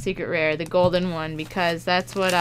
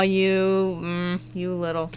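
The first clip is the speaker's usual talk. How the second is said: ordinary talk, nothing marked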